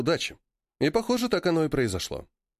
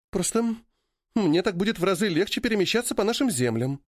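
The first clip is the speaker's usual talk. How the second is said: ordinary delivery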